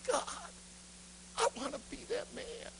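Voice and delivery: high, hushed tearful plea; acted sobbing prayer, partly voiceless; crying out. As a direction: plead to God as if weeping. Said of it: "Bill starts crying out".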